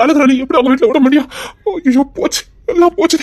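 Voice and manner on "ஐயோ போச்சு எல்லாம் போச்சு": despairing cry. Acted. Panics at his exposed lie.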